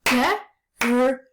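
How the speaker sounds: "G-R-U" broken into three clapped sounds; segmenting grew aloud for the class; clapping the sounds. Shown as a demonstration. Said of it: "Let's clap it".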